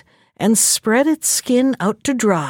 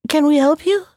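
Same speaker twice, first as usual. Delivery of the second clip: small bird voice, higher; little partridge voice; 6 semitones higher than usual. Acted. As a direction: chirp it like a tiny bird. Little partridge offers help.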